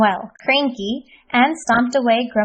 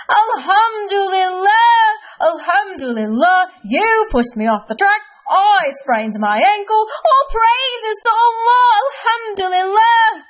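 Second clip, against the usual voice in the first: high, loud cries of praise; joyful shout; 10 semitones higher, 5 dB louder, slower than usual. Acted. Kareem finally grateful, high loud joyful shouts. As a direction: shout thanks to the sky.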